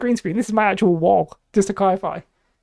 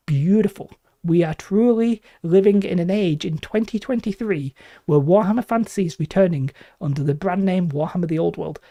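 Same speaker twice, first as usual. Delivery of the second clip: ordinary delivery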